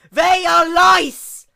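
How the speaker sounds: very high, loud, slow yell. Acted. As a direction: scream each word out, drawn long. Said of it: "Luna yelled".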